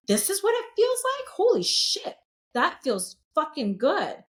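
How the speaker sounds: high, breathless wonder at a first taste of zen; blissful amazement. Retold or imitated. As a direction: gasp it out like a revelation. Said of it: "I'm like".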